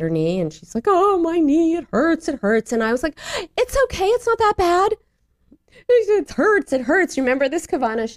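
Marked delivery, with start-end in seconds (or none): 0.9-2.7 high mock child's wail; crying little daughter. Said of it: "that's when she stopped crying". 3.6-4.9 very high, hurried parental brush-off; dismissive mother. Retold or imitated. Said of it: "doing that thing I tell people never". 5.9-7.2 high mock wail, easing down; crying little daughter. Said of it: "that's when she stopped crying".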